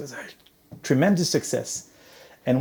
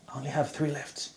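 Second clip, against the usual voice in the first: soft aside; 12 dB quieter than usual. Real, not acted. Speaker aside about time left.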